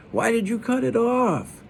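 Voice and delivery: a touch of hurt anger; mildly angry sister. A narrator's reading. Sister demanding why he cut his braid, mildly angry. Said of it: "almost like she was angry".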